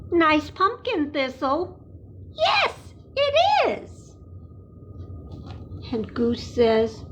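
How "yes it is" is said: much higher small animal voice; high hedgehog voice for kids; squeaky critter voice. Acted. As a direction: play tiny Thistle, pleased. Thistle answers in a storybook.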